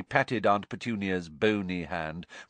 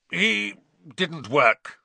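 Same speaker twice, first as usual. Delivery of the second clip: slow, higher grudging uncle; Uncle Vernon voice; 8 semitones higher, 5 dB louder, much slower than usual. A narrator's reading. Uncle answering his sister, slow and higher. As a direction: mutter it out as the uncle.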